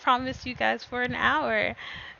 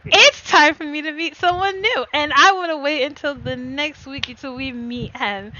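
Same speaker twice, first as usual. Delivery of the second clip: excited; 2 semitones higher, 5 dB louder than usual. Real, not acted. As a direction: gush about the next week. Excited chat closing the chapter.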